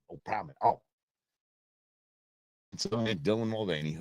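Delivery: normal talking voice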